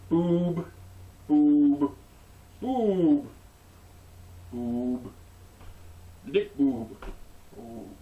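goofy repeated chant, each word punched; doing the guys groping in rhythm; mock boob chant. Men on boob duty chanting in a silly rhythm.

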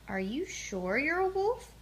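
lightly voiced gruff wolf; a touch of a big wolf's gruffness. A narrator's reading. A grown wolf questions Little Bear, mildly gruff voice. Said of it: "big, gruff voice".

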